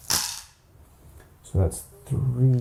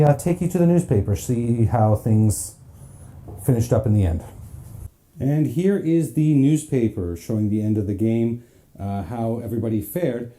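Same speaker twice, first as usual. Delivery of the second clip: usual speaking voice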